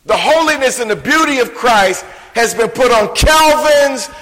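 preacher swelling to a fervent peak; preaching climax. Acted. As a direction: build it up like a pulpit crescendo. Sermon climax building.

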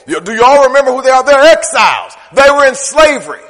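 loud, rousing sermon cadence; full-force preaching. Acted. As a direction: preach it out to the congregation.

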